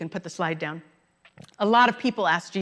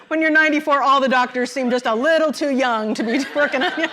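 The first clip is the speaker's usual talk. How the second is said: talking through real laughter, a little louder; chuckling over the punchline, louder and higher; laughing; 5 semitones higher, 4 dB louder than usual. Real, not acted. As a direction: laugh your way through the line, amused. Laughing at father-in-law's joke.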